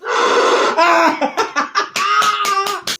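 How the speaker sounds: long, high cackle of delight; loud high laugh. Real, not acted. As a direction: burst out in loud, shrieking laughter. Cracking up at the recording.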